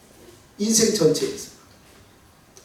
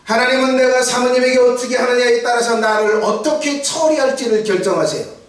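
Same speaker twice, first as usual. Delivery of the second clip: forceful preaching; 7 semitones higher, 5 dB louder than usual. Acted.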